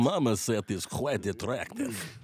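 put-on slow, lightly accented Russian vampire voice; Dracula's cousin. Friend mocks marmoset in slow vampire accent. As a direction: purr it slowly like a mild Count. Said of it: "I sound sort of like Dracula's cousin, Ivan".

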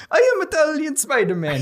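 high-pitched hammed-up Italian accent. Italian Spider-Man introduces himself, hammed-up Italian accent. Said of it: "with an Italian accent".

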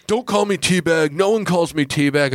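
high-pitched mock-offended objection; retold angry protest. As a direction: imitate a worked-up guy rejecting his nickname. Retold Teabag objects angrily again.

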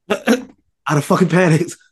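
high, laughing confession; amused hypothetical panic; laughing admission. Real, not acted. Laughing at imagined own panic.

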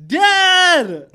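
high, loud call. Retold or imitated. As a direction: wake him up with one yell. Trying to wake sleeping dad.

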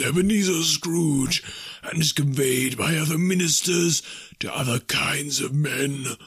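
slow, high wailing phantom voice; mournful ghost. A narrator's reading. Ghost telling Scrooge of its doom, mournful wail. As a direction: swell into a haunted lament.

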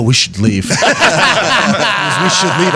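high, laughing imitation of a nervous father; dad urging his son to leave the fight, half-laughing fear; mock scared dad.

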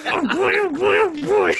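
high squawky Donald Duck impression. Shown as a demonstration. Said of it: "All right, here we go".

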